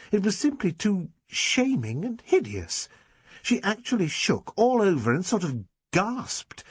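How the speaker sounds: put-on a girl scandalised and gossiping, pitch lifted; Dinah, indignant girl. A narrator's reading. Dinah voiced telling of Miss Prentice.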